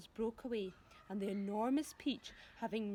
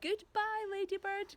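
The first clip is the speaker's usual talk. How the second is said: high, louder breathless farewell; gasped goodbye; 10 semitones higher, 4 dB louder than usual. Acted. James gasping farewell to the ladybird as they fall. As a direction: gasp it out clinging on. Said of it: "gasped James".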